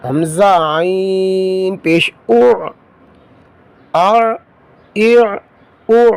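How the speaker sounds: slow, sing-song spelling chant; Qaida recitation drill. Shown as a demonstration. Teacher drilling pupils through Qaida spelling, slow sing-song chant. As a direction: call out each syllable slowly for pupils to repeat.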